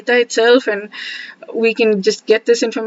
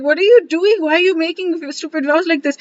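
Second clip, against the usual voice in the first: Krishna scolding; 7 semitones higher than usual. Retold or imitated. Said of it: "Krishna would be like".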